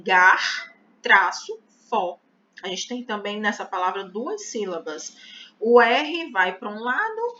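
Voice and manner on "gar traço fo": loud, split gar and fo apart; syllable split. Shown as a demonstration. Teacher splits garfo for the class, loud and deliberate. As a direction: say gar, pause, then fo. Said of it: "vai ficar assim".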